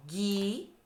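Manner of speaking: "Gui e gi" very slow and soft; slow contrast. Shown as a demonstration. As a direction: stretch out "Gui e gi" to contrast them. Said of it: "Olha a diferença".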